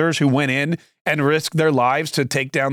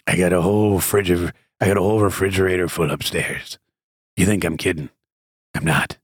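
much lower creepy voice; creepy Biden voice; 10 semitones lower than usual. Retold or imitated. Mocking Biden bragging about his fridge, creepy and much lower. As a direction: lurk over the mic in a low creepy voice. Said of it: "he gets the creepy voice back".

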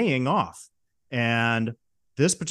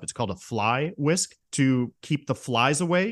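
ordinary delivery

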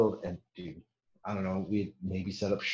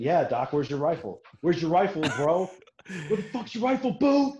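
builds higher and louder into laughing mockery; mocking teasing; 9 semitones higher, 5 dB louder than usual. Squad mates teasing the medic over his missing rifle, mocking. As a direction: pile on the teasing until it cracks into laughter.

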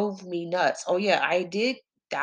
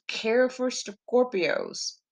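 ordinary talk, nothing marked

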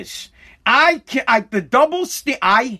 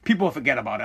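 mock dismissive; 9 semitones lower, 7 dB quieter, faster than usual. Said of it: "If you hit a chick".

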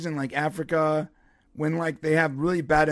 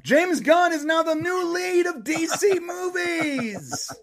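excited host announcement; 10 semitones higher, slower than usual. Shown as a demonstration. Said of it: "let me try it again".

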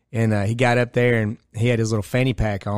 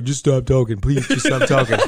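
a hint of a begging, rattled man; louder mock plea to stop; pleading adjuster; 3 semitones higher, 4 dB louder than usual. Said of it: "he was like".

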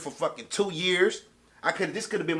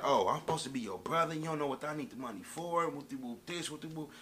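softer, trailing mock of the friend begging; mock whiny friend; 8 dB quieter than usual. Mocking the friend who wanted money, in a soft whine. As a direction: brush off his excuse in a mocking undertone. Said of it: "And then he tells me, like".

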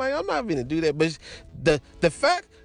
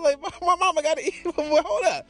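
high-pitched mock objection, amused; alarmed protest; 10 semitones higher than usual. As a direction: plead to stop, half laughing. Retold objection to the jelly.

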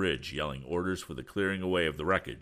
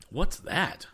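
high, loud startled question; young hero crying out in alarm; 11 semitones higher, 6 dB louder than usual. A narrator's reading. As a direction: cry out at a sudden noise. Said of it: "cried Tom".

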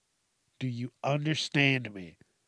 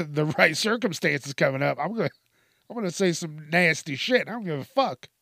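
usual speaking voice

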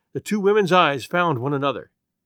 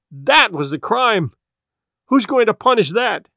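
anguished; 6 semitones higher, 5 dB louder than usual. A narrator's reading. Anguished outburst of guilt.

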